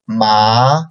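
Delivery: "ma" rising upward, second tone. Shown as a demonstration. Teacher reading the four tones to students, rising "ma". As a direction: slide "ma" up. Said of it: "let's read again".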